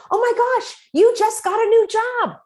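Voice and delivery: high, loud delighted reflecting; excited. Shown as a demonstration. Coach demoing happy reflection, high and loud. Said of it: "And you say".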